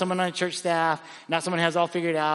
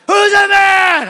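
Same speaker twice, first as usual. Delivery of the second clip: much higher, louder, slow bellow; booming shout; 13 dB louder, much slower than usual. Retold or imitated. Friend demanding a real answer, booming shout. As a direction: roar it out at him. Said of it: "He said".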